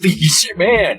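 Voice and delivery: amazed. Real, not acted. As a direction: react with real disbelief. Stunned by a rate of twenty-five dollars a minute.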